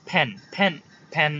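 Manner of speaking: "ফ্যান" as English pen with a puff on the p; aspirated P. Shown as a demonstration. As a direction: burst of air opening each "ফ্যান". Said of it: "তাহলে এটা ফ্যান".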